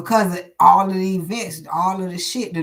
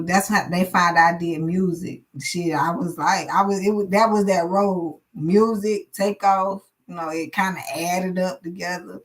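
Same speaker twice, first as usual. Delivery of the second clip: ordinary talk, nothing marked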